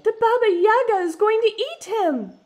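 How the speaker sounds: high, loud alarmed shout; panicked cry. A narrator's reading. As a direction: warn everyone in a panic. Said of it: "A voice from the crowd said".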